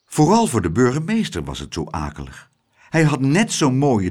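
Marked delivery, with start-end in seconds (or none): none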